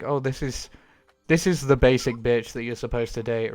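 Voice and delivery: ordinary talk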